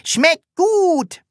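very high loud happy call; calling from afar. A narrator's reading. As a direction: shout it across the floor. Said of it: "rief es schon von weitem".

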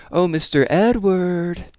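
high, drawn-out bleat; bleating call. A narrator's reading. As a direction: call out high and slow, stretching the name. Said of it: "bleated Miss Pearl over her shoulder".

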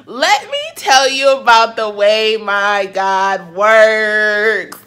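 slow, loud, emphatic testimony; preaching fervor. Real, not acted. Woman testifying to her followers, slow and loud. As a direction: preach it to the back row. Preaching about God to viewers.

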